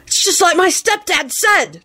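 put-on high, eager boyish blurt; exultant. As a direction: blurt it out thrilled. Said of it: "blurted out exultantly".

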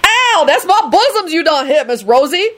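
high, indignant yelp; pained protest. A narrator's reading. Lucretia slapped in the dark, yelping indignantly. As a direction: yelp and complain at being hit.

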